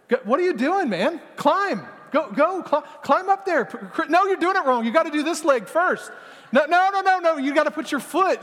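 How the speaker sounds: mock frustrated friend barking orders at Chris; pushy nagging. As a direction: nag him up the cliff, exasperated. Pastor acts pushy climbing coach.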